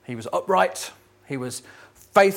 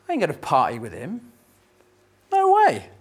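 sulky refusal. Acted. Older brother refuses the party.

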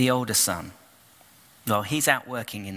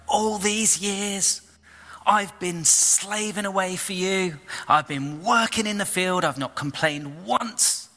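resentful complaint; 8 semitones higher than usual. Acted. Older son complains to his father, resentful and raised. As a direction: let years of grievance spill out. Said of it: "the son's not happy".

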